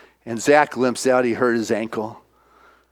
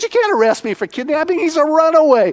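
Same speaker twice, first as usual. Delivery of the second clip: higher, quick indignant pushback; indignant protest; 13 semitones higher, 5 dB louder, faster than usual. Retold or imitated. Retelling Tyler snapping back at the social worker, mock indignant. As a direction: push back fast at the accusation.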